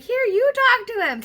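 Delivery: high, very loud panicked outburst; freaking out. Retold or imitated. Handing the cop the phone, freaking out. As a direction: imitate a frantic, shrieked plea. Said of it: "And so I'm freaking out".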